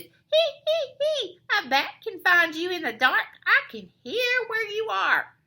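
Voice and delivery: high, loud tittering bat voice; high-pitched gloating bat screech. A narrator's reading. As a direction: screech it like a menacing cartoon bat. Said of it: "but Bad Egg screeches".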